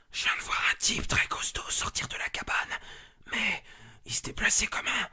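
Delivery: frantic whisper that cracks up high; whispering fast in terror, voice slipping out; panicked whisper. Acted. Reports the monster, hiding.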